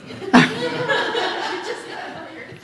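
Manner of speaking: airy high laugh; soft amused laugh; breathy laugh. Real, not acted. Laughs at own confession.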